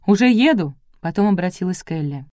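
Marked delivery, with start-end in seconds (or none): 0.1-0.8 loud call down the phone; shouting into phone. A narrator's reading. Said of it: "крикнула в трубку".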